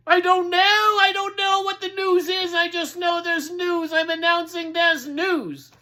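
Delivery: high, loud crier's voice; porcupine town crier. Acted. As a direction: announce with full conviction. Crier answers the pigs.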